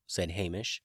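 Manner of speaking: plain reading voice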